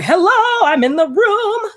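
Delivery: high, bright announcing of herself; loud, high flamboyant entrance. Retold or imitated. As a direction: burst in like you own the room. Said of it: "very flamboyant".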